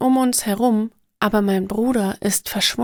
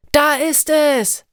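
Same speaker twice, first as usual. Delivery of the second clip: higher, louder, slow call of discovery; excited shout; 5 semitones higher, 4 dB louder, slower than usual. A narrator's reading. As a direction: shout out spotting the treehouse. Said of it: "schreit Michael". (aufgeregter Ruf, lauter und höher)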